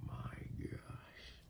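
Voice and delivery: stunned whisper under the breath; hushed disbelief. Acted. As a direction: let it out as a whisper. Overwhelmed aside to the partner.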